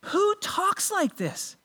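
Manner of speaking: high, slow question in soft disbelief; incredulous. Acted. Preacher challenging the congregation with the question, incredulous. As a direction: wonder aloud, drawn out and baffled.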